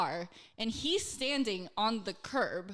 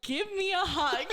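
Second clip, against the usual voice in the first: mock drunk guy; 7 semitones higher than usual. Mimics the drunk guy, laughing.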